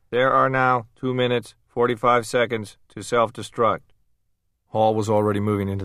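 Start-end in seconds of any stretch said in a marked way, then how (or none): 0.1-3.9 higher, louder automated announcement; mechanical voice. A narrator's reading. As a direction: read it like a machine countdown. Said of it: "The mechanical voice said".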